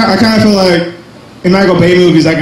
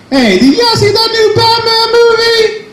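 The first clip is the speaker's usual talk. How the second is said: high, sung-out announcer voice; Batman voice bit. Retold or imitated. Comic launching his Batman bit in a high sung voice. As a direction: croon the question way up high. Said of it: "let's do this one".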